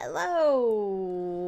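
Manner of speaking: ordinary talk, nothing marked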